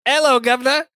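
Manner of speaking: high mock British greeting; hammed-up Brit saying hello; over-the-top British hello, lifted high; British accent. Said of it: "I don't do accents".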